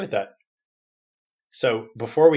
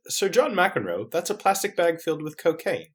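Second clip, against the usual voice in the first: higher, measured gentleman detective; prim, raised-pitch gentleman; Professor Layton; 5 semitones higher, 5 dB quieter than usual. Acted. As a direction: politely correct a foolish partner. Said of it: "Layton says".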